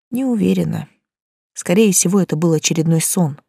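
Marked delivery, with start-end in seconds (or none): none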